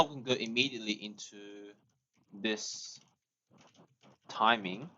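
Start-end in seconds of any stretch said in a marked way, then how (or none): none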